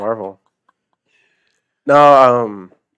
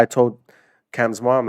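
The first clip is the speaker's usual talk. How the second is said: ordinary talk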